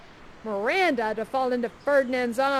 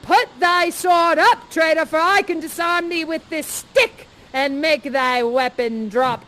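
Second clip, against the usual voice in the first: louder, slow stern command; commanding Prospero; 6 dB louder, slower than usual. Acted. Prospero disarming Ferdinand, loud and commanding. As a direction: order him to stand down. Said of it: "And I say".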